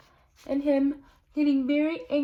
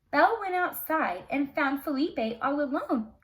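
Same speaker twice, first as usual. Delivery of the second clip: ordinary delivery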